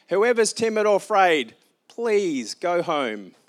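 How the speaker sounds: slow booming call to the fearful; grand proclamation. Acted. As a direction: announce it to the whole army. Lord's invitation to the army.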